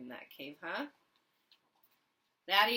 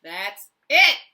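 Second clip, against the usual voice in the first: much louder furious snarl; 2 semitones higher, 20 dB louder than usual. Acted. Dragon losing patience with the poking boy, snarling loud. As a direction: snap it out, patience gone. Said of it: "Theodore snarled".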